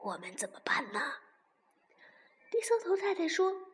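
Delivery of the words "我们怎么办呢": hushed whisper to his wife; whispering worriedly to his wife. A narrator's reading. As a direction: breathe the question so the fox can't hear. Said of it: "小声地问太太".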